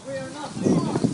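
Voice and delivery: defiant shout. Real, not acted. Eviction standoff, refusing to leave.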